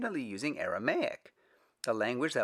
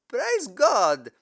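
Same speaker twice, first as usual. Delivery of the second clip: loud, high joyous cry; joyful shout; exclaimed; 13 semitones higher, 11 dB louder than usual. Acted. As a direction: burst out in praise. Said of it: "Stephen exclaimed".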